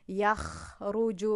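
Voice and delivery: slow articulation of يخرج from the top of the throat; throat letter demo. Shown as a demonstration. Teacher demonstrating throat letters to students, slow and deliberate. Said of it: "از اولی گلو".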